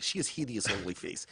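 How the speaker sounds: Russian accent on hideous. Shown as a demonstration. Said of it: "Russian is very easy".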